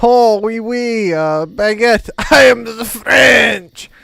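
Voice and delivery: loud, hammed-up French accent; exaggerated French accent, building to a drawn-out shout; mock French accent. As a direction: shout it as a cartoon French caricature. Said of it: "can I do a French accent?".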